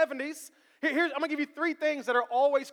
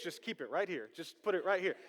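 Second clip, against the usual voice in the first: low and soft, lightly imitating; quoting Will Smith; 6 semitones lower, 8 dB quieter than usual. Preacher quoting Hitch to the church, low and soft. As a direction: murmur it like the movie line. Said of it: "Will Smith would say in Hitch".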